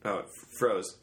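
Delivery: usual speaking voice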